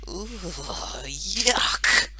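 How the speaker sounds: drawn-out groan of disgust ending in a sharp yuck. A narrator's reading. Narrator acts out a boy's horror of cotton wool, disgusted. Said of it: "It made him go".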